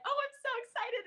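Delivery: high squeaky fake excitement; mock excitement. Mock excited quote of herself.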